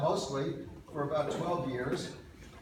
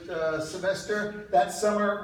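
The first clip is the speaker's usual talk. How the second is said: ordinary delivery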